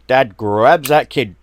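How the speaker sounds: ordinary delivery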